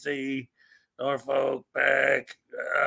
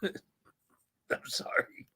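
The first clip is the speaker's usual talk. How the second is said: slow whispered apology through a laugh; 12 dB quieter, much slower than usual. Real, not acted. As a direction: breathe it out, still giggling. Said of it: "I don't know why I decided to do that".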